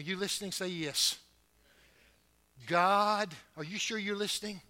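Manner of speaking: usual speaking voice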